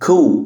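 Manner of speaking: "Coup" as "koo", no P; silent P. Shown as a demonstration. Teacher models the word, "Coup" with silent P. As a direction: end "Coup" on the vowel. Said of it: "ال P هنا silent".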